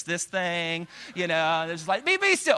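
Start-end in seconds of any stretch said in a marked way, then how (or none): 2.0-2.6 high sharp be still; dad's command. Retold or imitated. Dad to his kids, high sharp be still.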